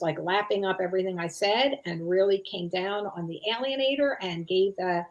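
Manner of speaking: normal talking voice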